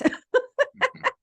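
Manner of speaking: a sudden high laugh, far above her speaking voice; high burst of laughter. Real, not acted. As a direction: let a real laugh break out. Laughs at own reaction.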